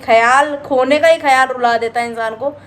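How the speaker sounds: ordinary talk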